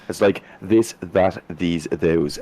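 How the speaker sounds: this, that, these, those with crisp English th; careful th sounds. Shown as a demonstration. Demonstrating to his friends, crisp proper English th sounds. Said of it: "That's the way the T H goes".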